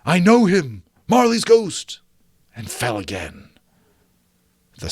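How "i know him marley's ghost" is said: high, loud cry; flame crying out. Acted. The leaping flame crying out at Marley's ghost, high and loud. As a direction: cry it out in alarm. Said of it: "as though it cried".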